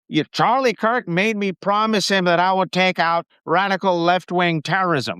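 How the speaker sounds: mocking Stephen Miller. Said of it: "And then Stephen Miller said".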